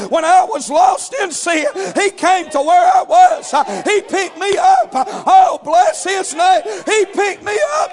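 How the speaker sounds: high shouted praise; shouting praise. Real, not acted. Preacher to congregation, shouting praise. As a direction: cry it out to the rafters. Climax of sermon.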